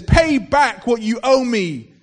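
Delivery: loud, slow, furious demand; angry demand. Acted. Servant choking his fellow servant, furious loud demand. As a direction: grab the debt back, loud.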